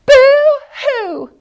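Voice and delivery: the echo answers Little Beaver. Acted. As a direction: call it back like a far echo. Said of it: "said the voice from the other side".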